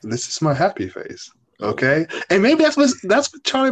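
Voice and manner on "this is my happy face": low, emotionless robotic imitation; C J's monotone. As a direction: profess happiness with zero feeling. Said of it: "he just monotone through life".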